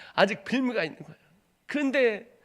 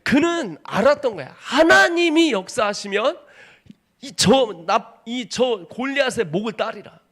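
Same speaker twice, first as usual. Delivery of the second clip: preaching at full force; 4 semitones higher, 8 dB louder than usual. Acted. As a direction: preach it to the rafters. Preaching David's battle. (힘차게 외치는 설교)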